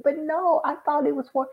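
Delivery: high-pitched mock objection; hesitant objection, pitched well up; retold protest.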